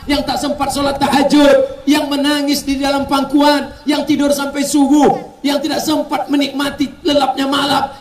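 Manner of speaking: rally the crowd about the wife; fervent preaching. Acted. (berkhotbah penuh semangat)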